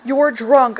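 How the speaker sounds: ordinary talk, nothing marked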